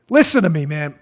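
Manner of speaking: normal talking voice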